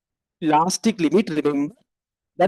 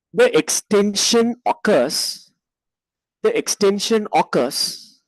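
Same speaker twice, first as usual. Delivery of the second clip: dictating. Shown as a demonstration. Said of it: "write like this".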